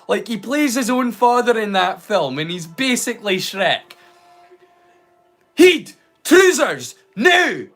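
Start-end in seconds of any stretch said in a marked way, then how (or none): 5.5-7.7 shouted Scottish dad; Scottish accent. Retold or imitated. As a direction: bark it in a thick Scottish accent. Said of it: "his accent's fairly spot on".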